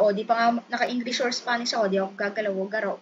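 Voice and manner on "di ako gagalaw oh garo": ordinary delivery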